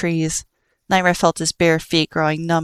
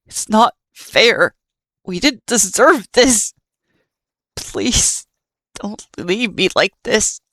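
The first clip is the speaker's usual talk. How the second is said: weeping plea; 4 semitones higher, slower than usual. Acted.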